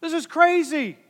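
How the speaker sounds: put-on loud, high, exasperated yell at the kids; dad yelling. Fed-up dad yelling at squabbling kids on vacation. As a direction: shout them down like a fed-up dad. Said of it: "No, he says".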